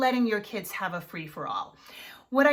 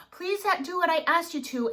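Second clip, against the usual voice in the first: polite plea; 7 semitones higher, faster than usual. Shown as a demonstration. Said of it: "this full on".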